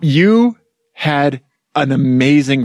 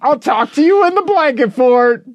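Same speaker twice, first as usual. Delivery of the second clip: high flustered voice; 13 semitones higher, slower than usual. Retold or imitated. Acting out his own text.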